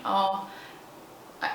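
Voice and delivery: ordinary delivery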